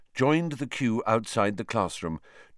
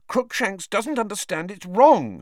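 shaking voice; 11 semitones higher, 5 dB louder than usual. Acted. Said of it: "her voice shaking".

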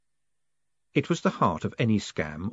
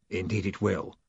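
grave; 3 semitones lower, 5 dB quieter than usual. A narrator's reading. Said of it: "said Gilt gravely".

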